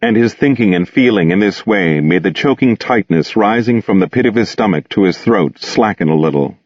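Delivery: ordinary delivery, nothing marked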